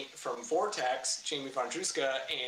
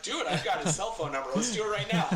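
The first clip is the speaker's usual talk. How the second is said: higher, challenging retold retort; pissed off; 4 semitones higher, 4 dB louder than usual. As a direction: re-enact a heated comeback. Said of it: "I'm pissed off".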